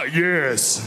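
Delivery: a gruff, deep mock mom "Yes"; mom's deep yes. Son announces a career, mom answers in a deep voice. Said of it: "My mom's voice just went down about four octaves".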